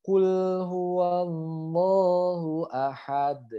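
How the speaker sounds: slow recitation, "ahad" ending in a released D; bounced final D. Shown as a demonstration. Teacher models the full verse, slow with bounced D. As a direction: hold "huwallahu" long and bounce the D of "ahad". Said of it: "Ya, jadi bacanya".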